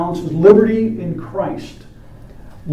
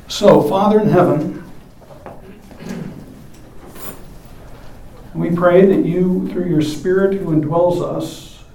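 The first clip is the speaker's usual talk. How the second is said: reverent benediction voice; prayer; 3 semitones lower than usual. Acted. Closing prayer.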